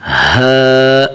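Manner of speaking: full heavy ہا. Shown as a demonstration. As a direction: fill the mouth for ہا. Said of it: "اسے موٹا کریں گے".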